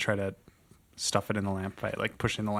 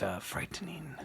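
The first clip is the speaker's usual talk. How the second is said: whisper; 6 semitones higher, 13 dB quieter than usual. Acted.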